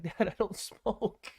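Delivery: high, drawn-out mock protest; exasperated protest. Son turning down his dad's weed offer, exasperated. Protesting to dad in retold exchange.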